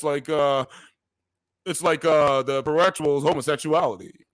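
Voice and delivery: half-hearted try at sounding like Obama; Obama impression. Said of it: "I can't really do his impression that well".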